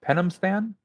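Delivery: Penamstan? asked with a high rising pitch; questioning Penamstan, pitch sweeping up; puzzled question. Real, not acted. Puzzled echo of the name.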